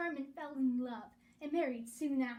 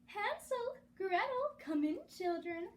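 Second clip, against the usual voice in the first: a light sing-song witch call, pitched higher; sweetly calling witch; 7 semitones higher than usual. A narrator's reading. The worst witch calling the children in, sing-song. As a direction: coax the children inside like a sly witch.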